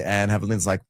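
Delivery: ordinary talk, nothing marked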